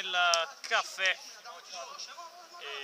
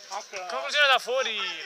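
excited commentary; 5 semitones higher, 5 dB louder than usual. Acted. Live match commentary.

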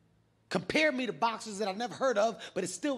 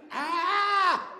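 loud, very high mock scream; hammed-up wail of panic; panicked scream; 11 semitones higher, 5 dB louder than usual. Acting out panic, blinded.

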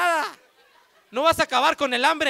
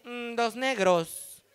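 dreamy choice; much slower than usual. Retold or imitated. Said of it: "cuáles quieres".

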